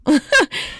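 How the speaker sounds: quick high laugh; short high laugh. Real, not acted. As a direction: laugh at the mishap. Computer falling off seat.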